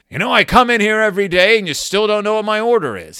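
hammed-up cranky regular; grumpy customer. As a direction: gripe at the barista. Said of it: "he says to the barista".

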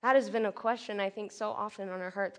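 ordinary talk, nothing marked

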